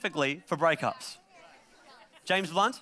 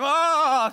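sudden louder crying wail; mock ugly cry; 9 semitones higher, 7 dB louder than usual. Imitating ugly crying in the car, mock wail. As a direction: let out a hammed-up sob. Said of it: "it's like".

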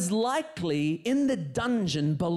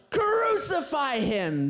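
crowd cry; 6 semitones higher, 3 dB louder, slower than usual. Acted. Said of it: "the crowd responded".

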